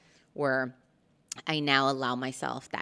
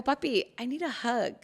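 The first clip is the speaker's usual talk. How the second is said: put-on childlike plea; 8 semitones higher than usual. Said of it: "And I go".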